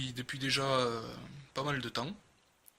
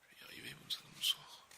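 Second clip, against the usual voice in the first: whispering; 10 dB quieter than usual. Real, not acted. Struggling to open the package.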